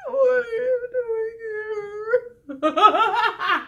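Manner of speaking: high crying-toned mock voice, laughing at the end; high mock whine breaking into laughter. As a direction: whine it, then crack up. Repeated mock greeting bit.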